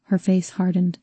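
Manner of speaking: ordinary delivery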